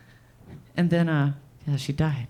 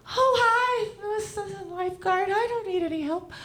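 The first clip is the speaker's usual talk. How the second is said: high, prim put-on voice of the mother; lifeguard mom voice; 12 semitones higher than usual. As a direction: play the mother as a snippy lifeguard. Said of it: "she'd be like".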